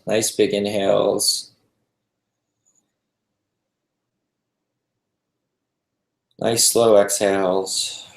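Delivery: calm, unhurried meditation guide with long pauses; guided meditation. Acted. Teacher guiding a group to relax into the breath, calm meditation voice. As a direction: ease them gently into stillness, leaving space between phrases.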